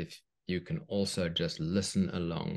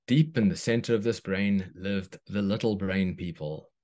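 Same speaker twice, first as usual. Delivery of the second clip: put-on reading to children; 3 dB louder than usual. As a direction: read it like a bedtime story. Reads a children's story aloud.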